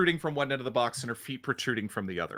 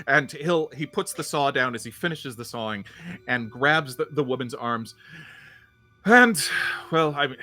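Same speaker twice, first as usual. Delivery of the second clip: ordinary delivery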